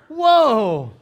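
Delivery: loud, high startled whoa. Retold or imitated. Preacher caught off guard by a heckler, loud startled whoa. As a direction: jump back in mock alarm. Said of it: "And I went".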